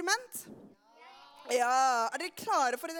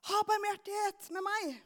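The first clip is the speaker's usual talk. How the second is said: retold cry; 5 semitones higher than usual. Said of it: "han roper til Jesus".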